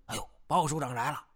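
a contained mock shout; mild cry of alarm. A narrator's reading. Officer warns others the chief is coming, mild cry. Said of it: "大叫一声".

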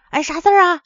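anxious question. A narrator's reading. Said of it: "妻子急忙问他".

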